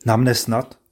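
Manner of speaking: ordinary delivery, nothing marked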